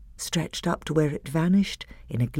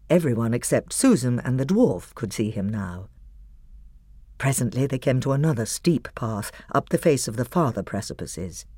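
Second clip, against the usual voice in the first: usual speaking voice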